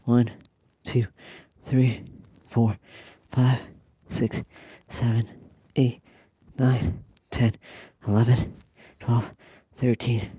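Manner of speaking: counting out loud forcefully. Real, not acted. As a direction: shout each number out. Loud counting set during exercise.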